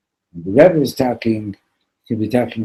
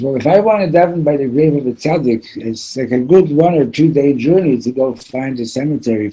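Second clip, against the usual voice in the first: higher, louder, pressing on; insistent explaining; 5 semitones higher, 6 dB louder than usual. Retold or imitated. Wife explaining to the preacher, higher and louder. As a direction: lay out the problem urgently. Woman's voice retold.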